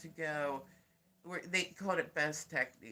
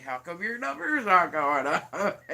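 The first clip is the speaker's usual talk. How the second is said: laughing; 9 dB louder than usual. Retold or imitated. People asking, laughing.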